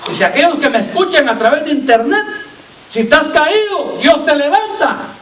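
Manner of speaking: high-pitched appeal, slowing at the close; preacher's ringing exhortation; impassioned preaching. Acted. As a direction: call out to the distant fallen listener. Exhorts listeners over the internet.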